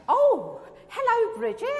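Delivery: high, loud startled hello breaking into a laugh; surprised greeting. Retold or imitated. Speaker surprised to meet Bridget again, laughing hello. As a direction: caught off guard, greet her with a laugh.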